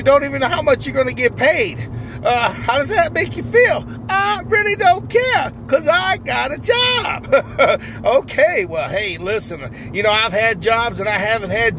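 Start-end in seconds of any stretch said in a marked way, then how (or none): 4.1-7.8 high, slow, giddy voice of a man who just got a job; overjoyed new hire. Acted. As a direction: squeal it out like the happiest man alive. Character answers the interviewer.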